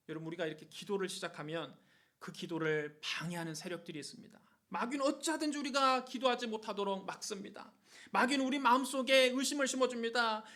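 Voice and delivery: ordinary delivery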